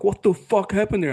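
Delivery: high disbelieving exclamation; mock shocked crowd. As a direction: imitate the stunned audience. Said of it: "everyone's like".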